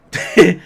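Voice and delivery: high burst of laughter. Real, not acted. Laughing at the lyrics.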